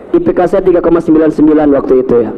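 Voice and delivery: ordinary talk, nothing marked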